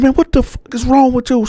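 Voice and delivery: retold yell, woken up angry. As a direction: yell at her for the slap. Woken by a slap, yelling.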